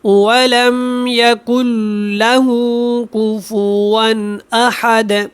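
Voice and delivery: وَلَمْ يَكُنْ لَهُ كُفُوًا drawn out; slow recitation. Shown as a demonstration. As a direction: recite the last ayah slowly. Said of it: "اس کی آخری آیت ہے". (آخری آیت آہستہ)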